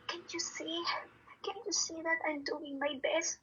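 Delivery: high, hushed, imploring retelling; tearful pleading. Retold or imitated. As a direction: plead softly, as if close to tears. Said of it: "Umiyak ako ngayon". (nagmamakaawa, parang naiiyak)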